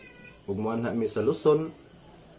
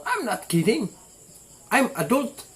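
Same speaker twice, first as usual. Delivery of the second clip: much higher, laughing put-on English; mock English; 11 semitones higher than usual. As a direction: squeak it out like a joking foreigner. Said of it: "Ano ako nga?". (binugalbugal nga Ingles nga hataas ang tingog)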